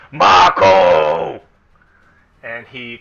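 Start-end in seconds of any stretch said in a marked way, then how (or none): 0.1-1.4 low, very loud ogre scream. Acted. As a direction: roar it out as a huge ogre. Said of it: "And then he screams".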